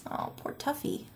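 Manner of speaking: plain reading voice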